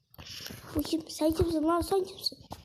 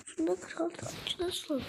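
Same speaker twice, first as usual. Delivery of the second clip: whispering; 9 dB quieter than usual. Real, not acted. Whispered question.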